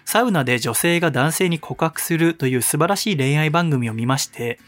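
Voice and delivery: measured, projected read-aloud voice; slightly theatrical radio letter delivery; radio mail reading. Real, not acted. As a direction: read a listener's letter out with deliberate, emphatic phrasing. Reads listener's mail aloud.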